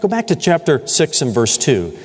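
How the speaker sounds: ordinary talk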